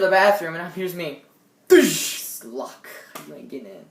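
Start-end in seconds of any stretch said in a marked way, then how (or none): 1.7-2.2 sharp, loud slam noise; imitated door slam. Teen acting out running into the bathroom, door-slam noise. 2.4-3.9 soft, low mock defiance; low defiant mutter. Teen acting out locking the bathroom, low defiant mutter.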